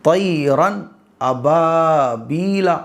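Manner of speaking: drawn out, syllable by syllable; very slow. Shown as a demonstration. Teacher showing slow recitation to students. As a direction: recite it slowly and deliberately. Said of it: "بالکل سلو ایک دم".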